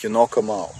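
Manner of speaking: ordinary talk, nothing marked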